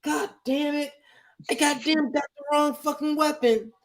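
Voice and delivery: high-pitched put-on kid voice; young kid. Kid cursing over his weapon in game, high mock-child voice. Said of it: "this kid would just come on, he'd be like".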